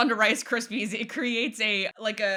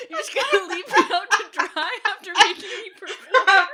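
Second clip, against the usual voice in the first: laughing; 8 semitones higher, 7 dB louder than usual. Real, not acted. Mock complaint while laughing.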